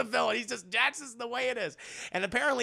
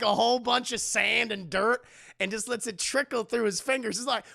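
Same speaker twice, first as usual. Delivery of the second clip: usual speaking voice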